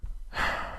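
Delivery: long weary exhale; deflated sigh. Acted. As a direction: let all the air out.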